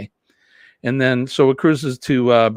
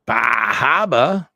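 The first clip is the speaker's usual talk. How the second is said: mock party whoop; 6 dB louder, much slower than usual.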